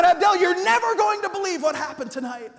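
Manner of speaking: high, loud, eager announcement; bursting to tell the boy something; lit-up bus captain; excited news. Retold or imitated.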